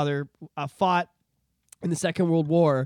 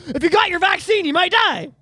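mock warning, raised and louder; mock news warning; 12 semitones higher, 8 dB louder than usual. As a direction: parrot the new official line, mocking. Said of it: "Now they're like".

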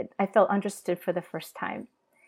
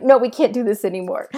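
louder, higher retold protest; mock exasperated refusal; 4 semitones higher, 9 dB louder than usual. Wife retells refusing her husband's touch, mildly exasperated and loud. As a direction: act out putting a firm stop to it.

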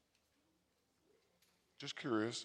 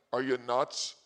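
usual speaking voice

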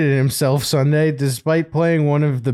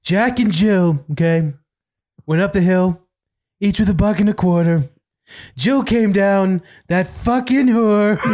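mock Trump nursery rhyme; 6 semitones higher than usual. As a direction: drag out the verse like a pompous reciter. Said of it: "I want Trump to, like, read nursery rhymes".

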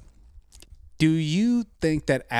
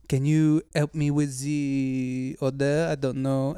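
put-on slow, drawn-out Parisian French accent; 3 semitones higher, slower than usual. Parisian stranger asking him for help with an order, French accent. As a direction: imitate a halting French-accented English speaker.

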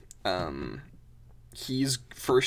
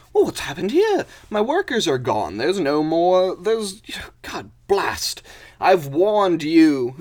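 loud, high indignation; hammed-up exasperated gang boss; blustering boss mole; 7 semitones higher, 9 dB louder than usual. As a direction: rage at missing workers, slowing for the warning. Boss mole finds his workers gone.

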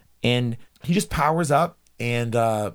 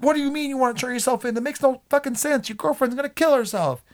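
much higher, rushed exasperation; mock disbelieving outburst; faster than usual. Fan pleading with character over the credits, high mock outrage. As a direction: squeal it in comic disbelief.

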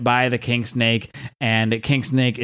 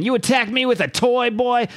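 villain voice; 11 semitones higher than usual. Acted. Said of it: "I don't even know what voice is supposed to do for King Snake".

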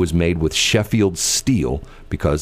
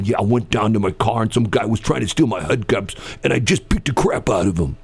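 a lightly voiced Brooklyn tough guy; 4 semitones higher than usual. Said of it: "like Joe Tough Guy".

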